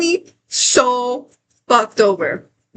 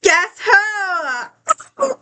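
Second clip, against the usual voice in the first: excited shout; 8 semitones higher than usual. Real, not acted. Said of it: "excited shout".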